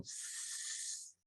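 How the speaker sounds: long, soft voiceless snake hiss. Shown as a demonstration. Teacher introduces herself as a snake, soft hiss. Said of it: "like a snake".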